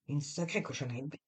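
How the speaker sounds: quiet echo. A narrator's reading. Alain repeats the overheard insult.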